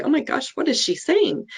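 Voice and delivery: high, hushed disbelief; shocked aside. Retold or imitated. Nurse stunned by the foster mom's blunt words, hushed and high.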